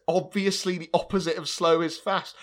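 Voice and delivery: gleeful mock reprise. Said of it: "It's so good".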